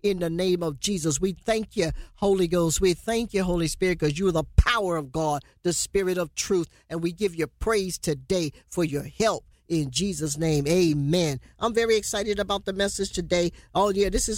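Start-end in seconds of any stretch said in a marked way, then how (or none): 0.0-11.4 lightly voiced prayer, a touch lower; opening prayer. Opening prayer before the sermon.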